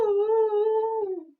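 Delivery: high, loud, hooting ghostly cry; ghostly ooh. Acted. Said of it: "came the ghostly cry again".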